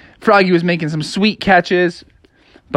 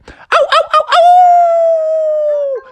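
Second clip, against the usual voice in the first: high howling ow ow; wolf howl; 5 dB louder, much slower than usual. Acted. Wolves howl at midnight.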